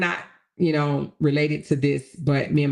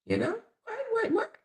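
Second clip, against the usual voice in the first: very high, soft squeak of shock; squeaky disbelief; 7 dB quieter than usual. Real, not acted. Reacting to the grandbabies news, high squeaky shock.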